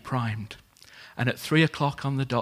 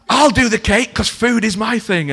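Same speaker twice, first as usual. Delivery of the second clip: loud and high, bursting in eagerly; eager loud offer; 9 semitones higher, 13 dB louder than usual. Retold or imitated. Diner owner eagerly offering to bake the cake, loud and high. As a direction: jump in keen to bake the cake.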